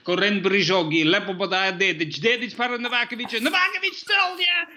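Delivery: mock-Slovenian play-by-play rising to a high shout; Slovenian-style commentary ending in a shout; Slovenian soccer commentary. Shown as a demonstration. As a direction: commentator hamming up the goal. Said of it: "the German one was".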